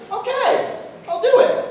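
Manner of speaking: high-pitched comic giving in; reluctant agreement. Acted. Farmer gives in to the Reverend, high and reluctant.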